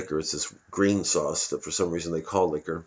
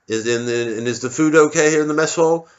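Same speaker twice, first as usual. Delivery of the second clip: officer; 7 semitones higher, 5 dB louder than usual. Retold or imitated. Officer questioning soldier.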